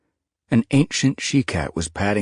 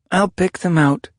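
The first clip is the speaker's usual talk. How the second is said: eager kit; 13 semitones higher, 6 dB louder than usual. Acted.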